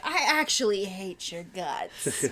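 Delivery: slow sassy villain line. Pretend. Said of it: "full of so much sass, like".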